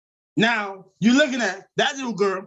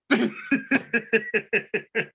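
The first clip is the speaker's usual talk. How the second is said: staccato wheezing laugh; 3 dB quieter than usual. Real, not acted. Cracking up at his own fat cat joke, laughing. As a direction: laugh it out in short repeated bursts.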